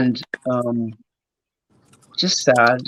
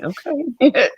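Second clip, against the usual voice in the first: meek mock okay; 11 semitones higher than usual. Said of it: "And I was like".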